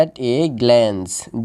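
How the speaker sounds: "at a glance" very slow, drawn out; teacher's slow model of "at a glance"; word by word. Shown as a demonstration. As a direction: stretch out each word of "at a glance". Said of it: "এটা একসাথে আমাদের উচ্চারণ করতে হবে".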